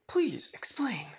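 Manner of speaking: high, breathy half-whisper; acted hushed demand. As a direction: whisper it pointedly at the culprit. Toga demanding explanation from Izuku.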